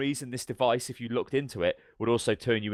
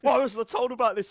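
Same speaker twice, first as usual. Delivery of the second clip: high, indignant imitation; mock panicked complaint; 13 semitones higher than usual. Rescuer complaining to the soldiers, mock panic. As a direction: protest in a pitched-up comic panic.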